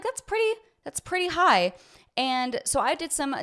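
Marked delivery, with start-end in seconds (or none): 0.0-1.7 much higher, mock-surprised "pretty high"; mock surprise. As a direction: act surprised at the big salary. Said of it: "I was like".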